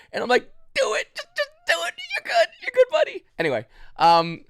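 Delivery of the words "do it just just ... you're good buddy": very high, tearful mock squeal; strangled falsetto whimper of reassurance; pained screaming. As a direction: reenact urging someone on through agony. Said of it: "I'm just screaming".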